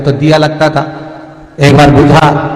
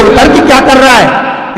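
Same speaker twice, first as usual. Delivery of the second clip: put-on slow, higher, loud old woman's voice; hammed-up old aunt scolding; cross old aunt; 7 semitones higher, 4 dB louder than usual. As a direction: croak out an old aunt's complaint. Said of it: "काकी बोले".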